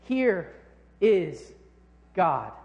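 "Here" "is" "God" each as its own word with pauses; slow proclamation of "Here is God"; spaced-out emphasis. Shown as a demonstration. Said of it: "writes in big, bold letters".

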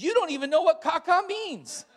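put-on high, loud defensive little girl. Daughter scolding her brother, high and loud. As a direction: snap back like a defensive kid sister. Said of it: "my daughter gets defensive".

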